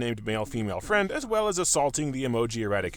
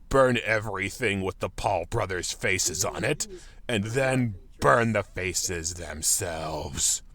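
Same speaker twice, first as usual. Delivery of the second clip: sinister low voice; 7 semitones lower, slower than usual. Acted. Menacing follow-up.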